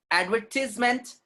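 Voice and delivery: advertisement as "ad-VER-tis-ment"; short i in the tis of advertisement; British pronunciation. Shown as a demonstration. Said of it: "is British sound".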